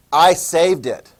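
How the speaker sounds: loud, slow, stubborn claim; sibling's claim. Retold or imitated. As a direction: plant your flag on the seat.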